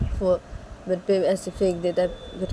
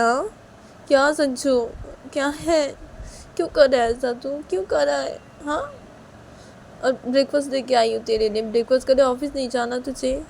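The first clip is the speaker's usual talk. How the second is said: higher-pitched motherly nagging; fretful scolding mother; 6 semitones higher than usual. Acted. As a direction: question the son in raised, worried reproach. मां बेटे को डाँटती है. (परेशान माँ की ऊँची डाँट)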